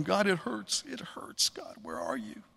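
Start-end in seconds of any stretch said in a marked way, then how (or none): 0.9-1.5 hushed, breathless whisper of pain; whispered aching prayer; whispered complaint. Retold or imitated. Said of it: "I might complain". 1.5-2.4 low, hushed whisper to God; a whispered cry of abandonment; whispered plea. Retold or imitated. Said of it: "I might complain".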